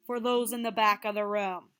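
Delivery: projected loudly to the back row; loud, carrying teacher voice. Real, not acted. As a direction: raise your voice so the whole room hears. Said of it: "let me say it a little louder".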